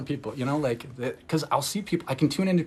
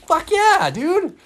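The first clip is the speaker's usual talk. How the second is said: much higher and louder cheer; whoop; 7 dB louder, much slower than usual. Real, not acted. Cheering herself on about the gold shirt, loud and high. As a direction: let it rip like a victory whoop.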